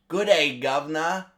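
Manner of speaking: British accent. Shown as a demonstration. Reviewer showing one of the movie's voices, British accent. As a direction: chirp it as a mock British greeting. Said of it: "that style of British accent".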